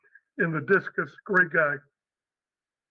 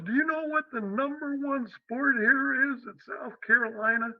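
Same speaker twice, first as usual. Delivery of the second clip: put-on real Southern accent; 7 semitones higher than usual. Head coach quizzing the new coach, real Southern accent. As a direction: quiz him in a Southern accent. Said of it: "in a real Southern accent".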